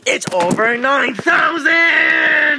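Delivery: loud, high, slow meme cry; meme yell. Pretend. Kids shout the over nine thousand meme, loud and high. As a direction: bellow it like the anime meme.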